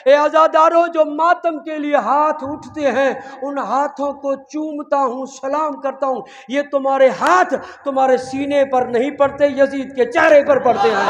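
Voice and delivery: high, loud, ringing declaration; loud proclaimed quote. Acted. Preacher quoting Khomeini to mourners, loud and ringing. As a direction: proclaim the quote like a rallying cry.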